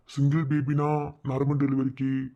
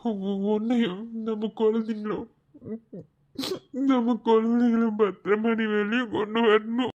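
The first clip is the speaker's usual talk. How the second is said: ordinary talk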